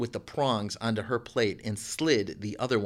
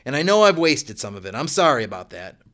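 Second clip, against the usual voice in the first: called out; 2 semitones higher, 7 dB louder than usual. A narrator's reading. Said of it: "called out".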